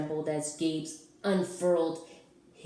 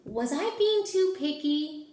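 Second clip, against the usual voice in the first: put-on high, fretful young voice; worried child voice; 11 semitones higher, 5 dB louder than usual. A narrator's reading. Teacher reading, child narrator frets over being too picky. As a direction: fret out loud like a little kid.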